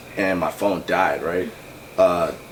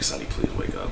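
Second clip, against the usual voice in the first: low, softer desperate plea; pleading; 11 semitones lower, 5 dB quieter than usual. Retold or imitated. Banging on his friend's door at four a.m., a low desperate plea. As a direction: beg him to wake up, dropping low.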